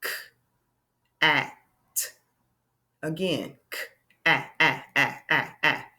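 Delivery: each sound split and repeated; tapping out cat. Shown as a demonstration. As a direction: tap out k, a, t. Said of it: "Let's tap out cat".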